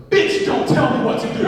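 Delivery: loud, high mock outburst; acted angry retort. Man on the phone turns on the mom, yelling in anger. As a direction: fire back as the defiant passenger. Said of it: "and goes".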